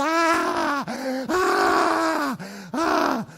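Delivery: high repeated screams; terrified screaming. Retold or imitated. Sister Sônia bursts out of her room, shrieking in fright.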